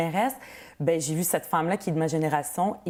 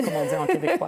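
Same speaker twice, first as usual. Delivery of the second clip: high, laughing aside; amused laugh, much higher; 12 semitones higher than usual. Real, not acted. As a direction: chuckle through the joke about Québécois. Guest jokes about Québécois expression.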